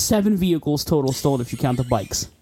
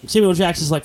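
normal talking voice

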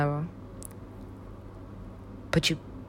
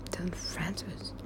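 slow whispered name; 19 dB quieter, slower than usual. Real, not acted. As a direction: hiss the name slowly under your breath. Whispered name after plain line.

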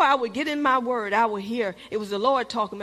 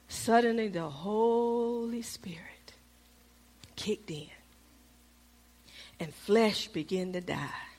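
slow hushed whisper; dramatic whisper; 3 semitones lower, 7 dB quieter, slower than usual. Acted. Preacher telling the congregation the Spirit moved, in a slow whisper. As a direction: drop to a slow whisper for the turn.

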